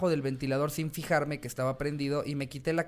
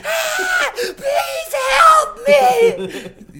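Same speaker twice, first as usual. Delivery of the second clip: put-on child screaming; 12 dB louder, much slower than usual. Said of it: "el niño está gritando así".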